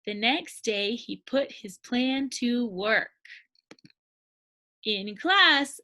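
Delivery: usual speaking voice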